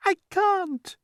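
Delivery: very high wail. A narrator's reading. Glowworm answering the grasshopper, wailing. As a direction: wail in despair. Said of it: "wailed the glowworm".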